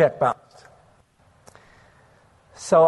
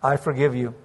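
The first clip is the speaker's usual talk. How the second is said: gentle forgiveness; 4 semitones lower than usual. Retold or imitated. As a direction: say it low and tender.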